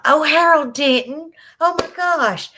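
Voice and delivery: high, loud burst of delight; thrilled surprise. Real, not acted. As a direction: gush at a welcome surprise. Thrilled by a viewer's message.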